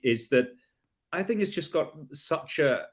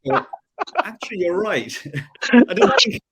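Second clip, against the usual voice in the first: much higher and louder, laughing surprise; sudden realisation; 9 semitones higher, 6 dB louder than usual. Real, not acted. To friends, realising his son was right, laughing surprise. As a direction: it just dawned on him.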